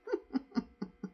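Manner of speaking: short breathy chuckle; amused little laugh, mostly breath; real chuckle. Real, not acted. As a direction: chuckle briefly at the story. Narrator chuckles at her own story.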